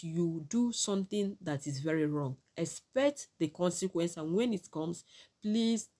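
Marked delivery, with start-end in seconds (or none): none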